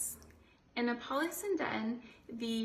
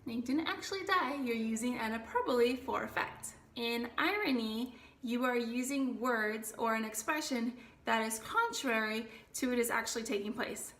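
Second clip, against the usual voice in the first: usual speaking voice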